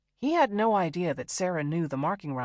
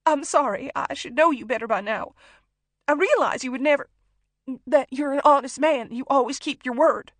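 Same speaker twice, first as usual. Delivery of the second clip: high, loud, desperate placating; frightened, weeping apology; tearful apology; 8 semitones higher, 11 dB louder than usual. A narrator's reading. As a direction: plead and flatter through tears. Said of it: "gave up trying to wipe her tears".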